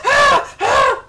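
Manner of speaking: short, high, loud screams; two sharp demonstrative shrieks. Shown as a demonstration. Viewer showing how they screamed, high short screams. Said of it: "this is how I was screaming".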